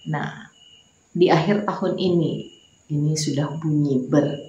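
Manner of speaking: normal talking voice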